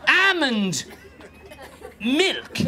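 "Almond", long pause, then "milk", both pitched high; disbelieving "Almond ... milk", one word at a time; words pulled apart. Shown as a demonstration. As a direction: set "Almond" and "milk" apart, each falling. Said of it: "those two words being put together".

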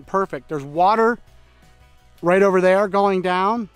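ordinary delivery

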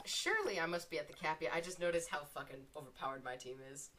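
ordinary talk

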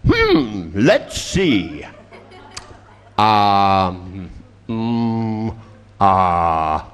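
mock searching for an answer, with long pauses; exaggerated pondering. Client asked how they know to be depressed, hammed-up pondering.

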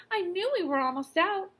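mother scolding herself, high and anxious; mother's voice. A narrator's reading. Mother to husband about the aspirin, high and fretful. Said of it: "said Miss Gridley".

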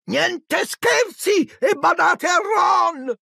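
very high, drawn-out order; high-pitched maternal command; mother's warning. A narrator's reading. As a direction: call out a slow, shrieky admonition. Mother orders the twins firmly.

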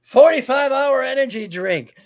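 loud, high, hammed-up energy drink pitch; hyped ad voice. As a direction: sell it like a manic commercial. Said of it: "And she's just like".

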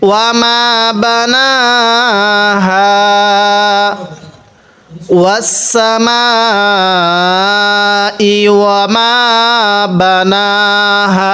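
slow, drawn-out tajweed chant; Quran recitation. Shown as a demonstration. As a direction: recite each word slowly with long vowels. Teacher recites verse after spelling.

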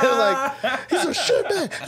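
high laughing repeat, slowed; cracking up at the insult. Real, not acted. Laughing at the memory.

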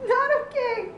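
upset protest. Real, not acted. Upset at the ending.

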